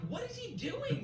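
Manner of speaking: high-pitched bewildered what-is-he-doing; baffled lady. Retold or imitated. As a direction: play the stunned diner staring at him. Lady stares at the manager.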